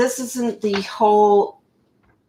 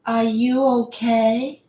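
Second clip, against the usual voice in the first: higher-pitched, slowed little-girl mimicry; mock child voice, pitched up; six-year-old voice; 4 semitones higher than usual. Reading her side of the call, mock six-year-old voice. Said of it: "in her six-year-old voice".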